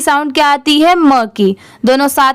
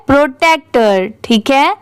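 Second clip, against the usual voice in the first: ordinary talk, nothing marked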